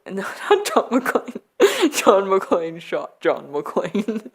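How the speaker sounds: giggling through the correction; cracking up mid-sentence; laughing. Real, not acted. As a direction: laugh at your own slip while talking.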